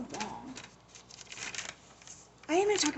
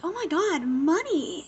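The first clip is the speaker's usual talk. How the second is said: higher and louder delighted cry; gleeful excitement; 6 semitones higher, 9 dB louder, slower than usual. Retold or imitated. Foggy reacts to the law firm being paid, loud and giddy. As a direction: squeal over the cash like a kid. Foggy sees Electra's payment.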